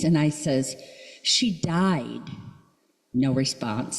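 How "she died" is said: normal talking voice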